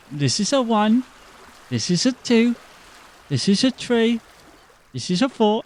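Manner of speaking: hint of a patient teacher; lightly voiced teacher counting.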